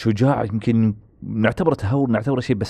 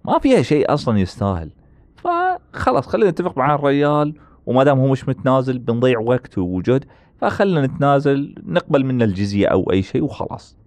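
lightly voiced old vizier; 5 semitones higher than usual. Voicing Halil Pasha's advice.

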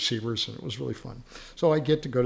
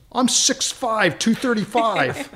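boastful quarterback; 10 semitones higher, 6 dB louder, slower than usual. Retold or imitated.

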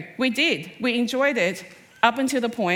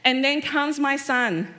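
usual speaking voice

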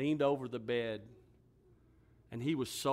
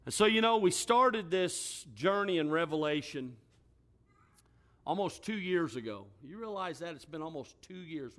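higher, louder pulpit preaching building to a shout; full-force preaching; 6 semitones higher, 8 dB louder than usual. Acted. Pastor preaching to his congregation about facing death, rising to a shout. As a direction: drive the scripture home to the church.

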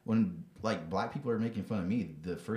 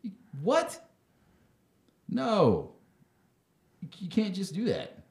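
incredulous outburst; 10 semitones higher, slower than usual. Real, not acted. Ranting at a fake apology.

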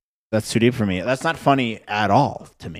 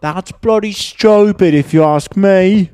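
high, slow, hammed-up British toff voice; mock British accent; 10 semitones higher, 6 dB louder, slower than usual. American host mocks British slang in a hammed-up British accent. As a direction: sneer it out in a put-on posh British accent.

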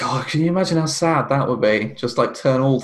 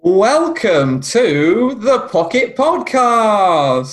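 slow, drawn-out show intro; announcer voice; 6 semitones higher, 4 dB louder, much slower than usual. Acted. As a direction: stretch it like a jingle. Podcast intro jingle voice.

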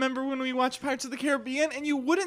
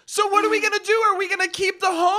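heated argument; 7 semitones higher, 9 dB louder, faster than usual. Acted. Rising marital argument.

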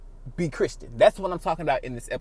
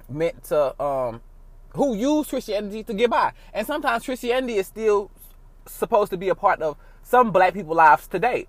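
ordinary talk

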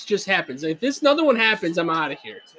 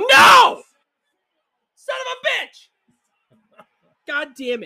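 high, loud angry yell at the game; real outburst of sports rage; furious shout; 6 dB louder, much slower than usual. Real, not acted. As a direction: explode at the bad play.

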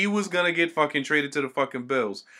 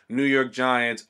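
ordinary talk

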